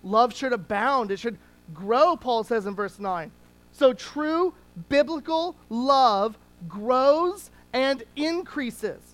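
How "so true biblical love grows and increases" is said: slow emphasis. Real, not acted. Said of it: "slow emphasis".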